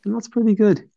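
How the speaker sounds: high, offhand approval; casual mock praise. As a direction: shrug it off as pretty good. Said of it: "And I go".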